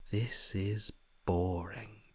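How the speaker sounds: slow, whispered boredom; listless sigh of a line. Acted. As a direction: drag it out, utterly uninterested. Bored voice sighs.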